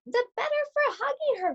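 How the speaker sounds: put-on wolf putting on a sugary old lady voice; wolf as sweet granny.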